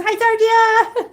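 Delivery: high, loud, drawn-out hello; squealed slow, gleeful greeting; group greeting. Acted. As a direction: greet the kitten for everyone, big and high. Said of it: "Everybody says".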